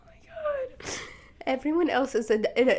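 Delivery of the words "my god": breathless whispered oh my God; awed hush. Real, not acted. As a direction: whisper it, spent from laughing. Overwhelmed after laughing.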